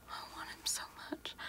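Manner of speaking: breathless whisper of longing. Acted. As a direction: let it fall to a bare whisper.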